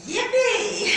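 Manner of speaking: high, loud squeal of mock delight; gleeful whoop. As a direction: whoop it out with glee. Told the sofa opens into bed.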